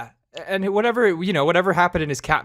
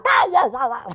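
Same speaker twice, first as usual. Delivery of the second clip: very high chattering meow; imitating cat; 11 semitones higher than usual. Cat greeting owner at the door, high yowling meows. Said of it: "he'll fucking run up to the door".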